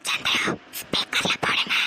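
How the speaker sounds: slow, secretive whisper; urgent whisper. Acted. Girlfriend prompting him during mother's call, whispering. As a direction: whisper so the caller cannot hear.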